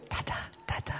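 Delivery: whispered, tender baby-word imitation; soft baby talk. Father whispering his baby daughter's first word. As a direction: breathe it like a baby's first word.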